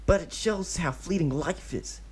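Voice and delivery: lightly voiced solemn musing; high earnest reflective. A narrator's reading. Obito telling the kid life is short, solemn. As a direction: muse on mortality.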